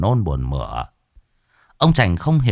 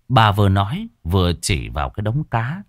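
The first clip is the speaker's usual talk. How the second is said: usual speaking voice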